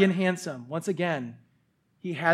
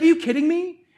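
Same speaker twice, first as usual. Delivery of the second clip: higher, louder scoffing question; mocking disbelief; 10 semitones higher, 4 dB louder than usual. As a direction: scoff at the boy. Soldiers laughing at young David.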